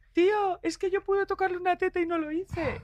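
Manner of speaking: teasing impression of a friend's future regret; regretful future Carolina. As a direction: play her at fifty lamenting a missed chance. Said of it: "tendrás cincuenta años y dirás".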